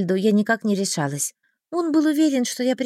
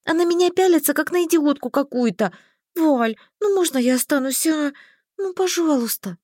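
high complaint turning to begging; wheedle, pitched up; whiny pleading; 6 semitones higher than usual. A narrator's reading. Heroine begs the mage to stay behind, pleading.

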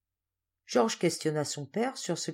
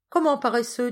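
higher, louder playful scolding; lively teasing call, pitched up; laughing tease; 8 semitones higher, 7 dB louder than usual. A narrator's reading. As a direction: exclaim it as a jovial mock reproach. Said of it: "dit-il en riant".

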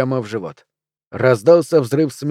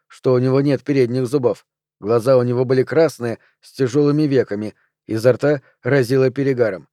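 usual speaking voice

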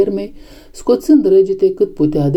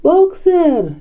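high, drawn-out cry of dread; terrible cry; 12 semitones higher than usual. A narrator's reading. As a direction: call out in desperate alarm. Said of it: "cu o voce teribilă". (strigăt teribil, pe ton înalt)

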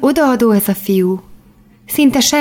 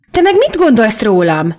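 sharp indignant snap, a little louder; snapping; 3 semitones higher, 3 dB louder than usual. A narrator's reading. Girl snapping at Daniel over the snow. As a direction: bite back at him, offended. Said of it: "förmettem rá a fiúra".

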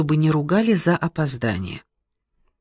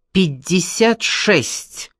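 steady; 4 semitones higher, much slower than usual. Acted. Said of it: "не дрогнула наша героиня".

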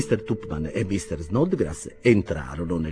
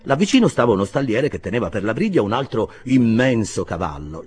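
usual speaking voice

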